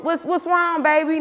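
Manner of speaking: mock sleepy boyfriend. Voices boyfriend waking up.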